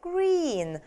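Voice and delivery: green with a long, stretched ee; drawn-out vowel. Shown as a demonstration. Teacher showing learners the long vowel in green. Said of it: "Нужно немножечко потянуть".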